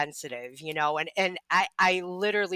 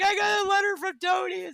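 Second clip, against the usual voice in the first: frantic retold self; 13 semitones higher than usual. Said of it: "And I'm like".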